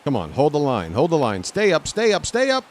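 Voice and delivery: will the ball over the fence; urging the ball. Real, not acted. Streamer pleads with his fly ball, rising high.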